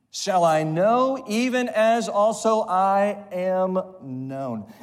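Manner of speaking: slow, drawn-out scripture reading; slow recital with congregation. Shown as a demonstration. Preacher leading the congregation through the verse, slow. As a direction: read it slowly with the people. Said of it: "Say it with me".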